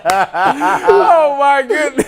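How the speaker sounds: high-pitched, laughing exclamation. Real, not acted. Told the NFL called about him, helpless laughing. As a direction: gasp it out through laughter.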